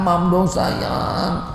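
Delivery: put-on soft, slow baby talk to a toddler; coaxing mother. Anxious parent coaxing a two-year-old to eat, soft and slow. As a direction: coax the little one to eat.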